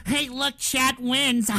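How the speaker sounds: put-on gloating chat voice with a fake laugh; mocking viewer voice. Streamer mimicking chat thinking they won, mocking cackle. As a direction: imitate smug trolls, then cackle. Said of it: "thinking that they're".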